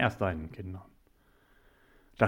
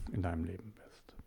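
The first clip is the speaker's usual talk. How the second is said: ins Flüstern abfallend; flüstere das Ende vertraulich; whisper; 2 semitones lower, 15 dB quieter than usual. Real, not acted. Eindringliche Botschaft an Zuhörer. (vertraulich geflüstert)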